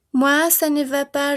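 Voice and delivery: ordinary talk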